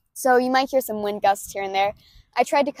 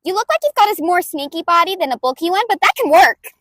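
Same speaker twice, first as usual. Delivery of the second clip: higher, much louder, pushed from the back of the throat; brash inventor girl, throaty and loud; loud brutal May voice; 6 semitones higher, 6 dB louder than usual. Acted. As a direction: bulldoze him with gleeful energy. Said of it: "I do it in the back of the throat, and I do it loud".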